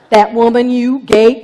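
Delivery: loud, defensive finger-pointing; Adam loudly blaming the woman. Acted. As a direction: shout the blame onto her. Adam blaming Eve to God.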